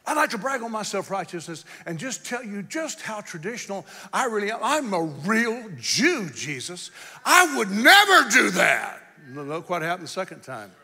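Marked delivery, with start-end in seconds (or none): 0.1-9.0 preacher mocks Peter's boastful piety; mock self-righteous Peter. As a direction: parody his pride. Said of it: "What he was saying is".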